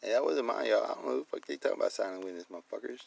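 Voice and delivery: ordinary delivery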